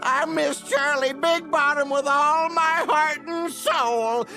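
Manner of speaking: high, loud heartbroken wail; melodramatic lament. Acted. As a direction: wail it out into a long sob. Mourning a lost companion, melodramatically.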